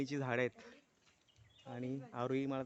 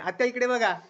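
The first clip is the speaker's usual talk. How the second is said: loud laughing call to aunt; 7 semitones higher, 10 dB louder than usual. Real, not acted. Uncle calls out to his aunt, loud and laughing. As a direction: shout across to get her attention.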